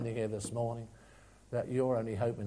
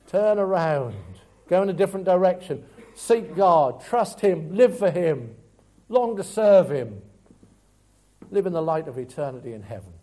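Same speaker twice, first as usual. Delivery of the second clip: louder, pounding call to repent; fiery preaching; 3 semitones higher, 9 dB louder than usual. Acted. Preacher urging the congregation to turn to God, full-force preaching. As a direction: drive each command home.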